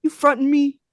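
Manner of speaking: mock street-tough challenge; tough guy. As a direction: puff up and confront. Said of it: "and he goes".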